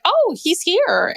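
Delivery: high, slow, mock-surprised realization; mock surprise. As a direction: lightly act out the surprised "oh". Retelling first meeting Jim in film.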